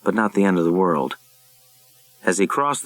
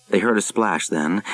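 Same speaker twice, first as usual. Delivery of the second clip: ordinary delivery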